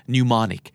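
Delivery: "mnemonic" said with silent M, starting on N. Shown as a demonstration. Teacher reads out the spelled word, "mnemonic" with silent M. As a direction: say "mnemonic" as "new-monic", no M sound. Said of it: "เป็น silent M นะครับ คือไม่ออกเสียง".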